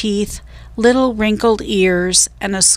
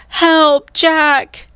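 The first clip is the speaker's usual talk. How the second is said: much higher, louder cry for help; distant cry for help; 8 semitones higher, 4 dB louder than usual. A narrator's reading. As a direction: scream for help from far away. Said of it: "came a cry".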